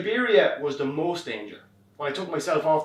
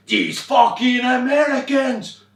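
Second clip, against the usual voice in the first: drawn-out furious yell, much higher and louder; 9 semitones higher, 9 dB louder, much slower than usual. Retold or imitated. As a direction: roar it out in slow rage. Driver yells in rage.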